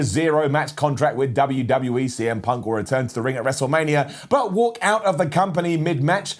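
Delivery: ordinary talk, nothing marked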